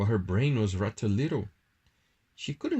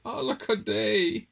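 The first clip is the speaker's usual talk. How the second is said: high, woeful storybook wail; theatrical cry; 11 semitones higher than usual. A narrator's reading. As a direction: wail it high in mock despair. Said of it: "And he cried".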